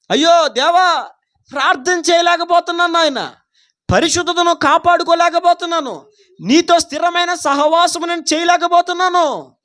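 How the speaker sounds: high, pleading cry to God; acted lament. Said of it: "సహవాసాన్ని కోల్పోతున్నానని". (ఆవేదనతో దేవునికి మొరపెడుతున్నట్టు నటిస్తూ)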